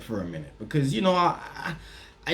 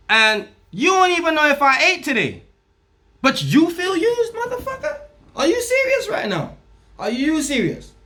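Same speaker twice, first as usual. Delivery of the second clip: mock outraged rant; 7 semitones higher than usual. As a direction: rant at her like you can't believe it. Angry rant at her, quoted.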